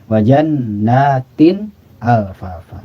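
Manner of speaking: slow drawn Quranic recitation of "Wa jannatin alfafa", the nun sounded clearly; izhar recitation. Shown as a demonstration. Teacher recites "Wa jannatin alfafa" slowly for students. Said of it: "Dibaca izhar".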